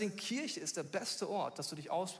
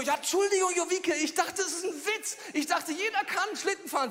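flustered apology; 10 semitones higher, 4 dB louder than usual. Retold or imitated. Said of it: "Und ich so".

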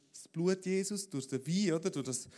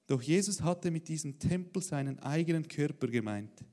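louder, steady reading of the Bible story; scripture reading; 3 semitones lower, 4 dB louder than usual. Acted. Pastor reading the temple story to the church, measured reading. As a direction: read the passage out to the congregation. Reads the Bible passage aloud.

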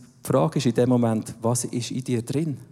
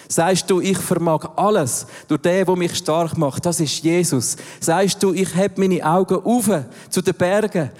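full-force preaching; 3 semitones higher than usual. Acted. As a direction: proclaim each verse like a battle cry. Preacher proclaims scripture responses.

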